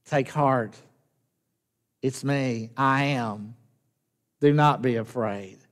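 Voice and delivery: God reassuring Moses, hushed; gentle divine voice. Retold or imitated. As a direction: speak it like a quiet promise. God speaking to Moses, softly.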